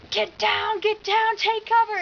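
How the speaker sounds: high, loud mock alarm; urgent shouted warning. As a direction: shout it like a sudden battlefield order, retold. Retold hypnotist sudden warning to take cover.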